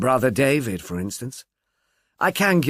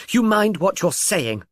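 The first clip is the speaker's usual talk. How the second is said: higher, slow, sharp threat; sharp warning; 7 semitones higher than usual. Acted. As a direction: warn him off, biting. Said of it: "her voice sharp and purposive".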